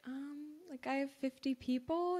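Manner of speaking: a little embarrassed, almost under the breath; quietly confessing a tiny list; sheepish reply. Retold or imitated. Sheepish reply to her mentor.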